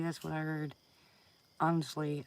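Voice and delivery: usual speaking voice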